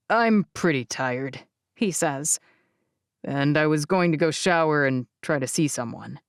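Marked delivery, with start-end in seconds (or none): 0.1-1.5 put-on lower, tired young man; grandson's voice. 3.2-6.2 put-on lower, tired young man; grandson's voice.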